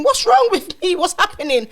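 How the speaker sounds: high, loud mock panic as she asks "What's wrong with me?"; replaying her own frantic questions; retold panic. Telling her partner back then, in a high panicked voice. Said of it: "I'm asking".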